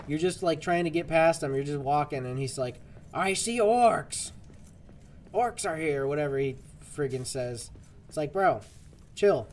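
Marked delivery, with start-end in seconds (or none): 3.1-6.1 high, slow mock yell; drawn-out, hammed-up warning cry; imitated character yell. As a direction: imitate a character loudly announcing enemies. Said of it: "You just yelled".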